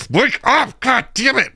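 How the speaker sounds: high mock-exasperated wife; annoyed wife. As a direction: shake him awake, fed up. Wife annoyed, waking him.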